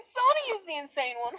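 usual speaking voice

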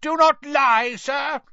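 high, loud angry shout. Acted. Mister Crouch with wand aimed at Ron, shouted. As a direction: shout the accusation down. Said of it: "shouted Mister Crouch".

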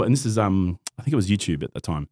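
usual speaking voice

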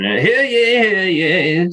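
high, ringing proclamation; town crier. Shown as a demonstration. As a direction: call it out like a herald. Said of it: "rather than".

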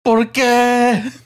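high, drawn-out Spanish wail; hammed-up high-pitched scream; mock anguished scream. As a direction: scream it out in comic agony. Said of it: "hear him screaming that morning".

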